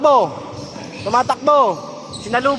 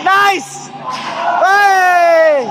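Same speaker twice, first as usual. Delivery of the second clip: higher, drawn-out courtside shout; excited cheer; 6 semitones higher than usual. Real, not acted. Cheering a play in the game.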